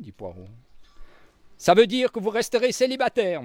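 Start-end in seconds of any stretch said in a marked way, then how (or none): none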